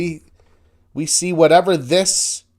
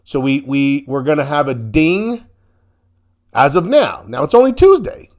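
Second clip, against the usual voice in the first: normal talking voice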